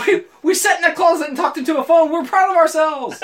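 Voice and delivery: high, loud hammed-up boast; mock proud excitement. Host mimicking their beginner pride, mock-giddy. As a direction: crow about it with silly pride.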